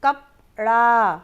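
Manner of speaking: कपड़ा drawn out slowly and firmly; slow emphatic answer. Shown as a demonstration. Teacher confirming the right word, slow and firm. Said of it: "मुंह से क्या उच्चारित किया था?".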